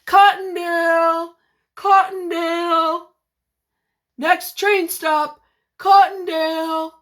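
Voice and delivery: slow, loud, high call of a train conductor; drawn-out conductor's announcement. Acted. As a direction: call the stop down the empty train. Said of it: "yelled the conductor".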